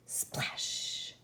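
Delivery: "splash" breathed out in a whisper. A narrator's reading. Narrator voicing the ball's fall, whispered. Sound of ball hitting water.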